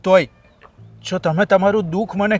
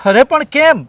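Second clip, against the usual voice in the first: higher and louder, startled; shocked question; 6 semitones higher, 7 dB louder than usual. Acted. Brother startled to hear she was thrown out. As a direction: react in disbelief.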